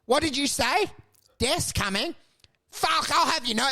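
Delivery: mock indignant old-timer; old guy. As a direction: challenge them like an offended old man. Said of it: "some old guy's gonna go".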